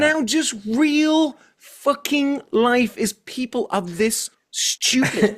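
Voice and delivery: high, slow, dismayed drawl; a viewer lamenting that real life is now this silly; exasperated. Retold or imitated. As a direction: sigh out your dismay slowly. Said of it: "And I'm like".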